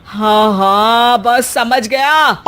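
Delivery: very slow, drawn-out sarcastic agreement; weary sarcasm. Acted. Son cutting off his bickering mother and wife, slow and fed-up. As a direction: drag it out, fed up with both.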